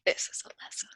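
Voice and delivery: hushed villain whisper; menacing whisper. Pretend. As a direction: breathe it like a threat. DM repeats it menacingly.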